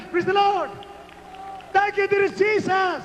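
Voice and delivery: high, slow, ringing thanks; praise cry. Real, not acted. Preacher thanking Jesus with congregation, high drawn-out cry. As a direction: cry out thanks over the crowd.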